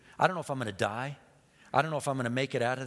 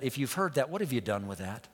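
normal talking voice

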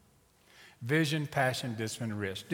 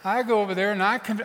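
ordinary talk, nothing marked

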